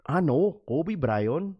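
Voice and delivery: put-on slow Filipino-accented line. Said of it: "That's exactly how they say that shit".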